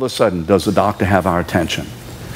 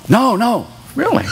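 much higher, mocking squeal of a critic; mock objector; 14 semitones higher than usual. Preacher mimics critics who object to preaching hell, high and mocking. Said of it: "some people would say".

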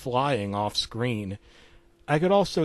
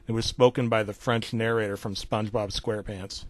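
ordinary delivery

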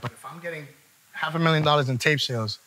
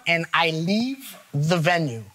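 ordinary delivery

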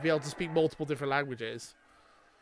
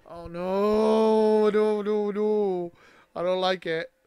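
higher and louder, rising dread; loud dismay; 5 semitones higher, 8 dB louder, much slower than usual. Real, not acted. Fan watching Tyrion confront the queen, loud dismay. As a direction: cry out in worry.

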